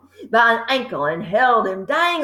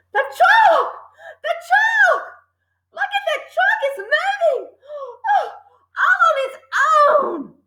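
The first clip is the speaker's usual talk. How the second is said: put-on very high, frantic boy's shriek; hysterical shrill scream. A narrator's reading. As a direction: shriek it in terror. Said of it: "hysterical and shrill was Nigel's scream".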